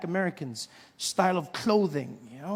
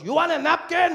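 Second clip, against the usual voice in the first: put-on high, louder Mexican-accented vendor; 10 semitones higher, 4 dB louder than usual. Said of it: "So he goes".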